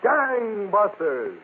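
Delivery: slow, booming radio announcer title; dramatic title announcement. Acted. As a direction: stretch the show title out slowly, like a big reveal.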